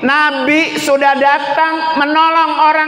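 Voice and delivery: high, stretched-out pulpit proclamation; preaching. Real, not acted. Preacher gives mothers the story's lesson, full-force preaching.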